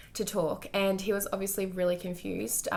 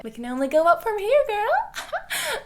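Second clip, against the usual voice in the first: upbeat cheer; 14 semitones higher, 7 dB louder, slower than usual. Real, not acted. Cheering on her viewers at the end, excited squeal. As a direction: lift it into a bright hype squeal.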